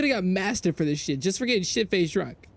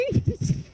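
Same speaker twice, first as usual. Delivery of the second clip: a sudden high burst of laughter. Real, not acted. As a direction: crack up high. Laughs at memory.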